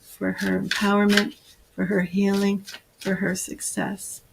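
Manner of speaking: soft, reverent channeling voice; hushed invocation. Acted. Healer calling on goddesses for her client, hushed and reverent. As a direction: murmur a prayer to the goddesses.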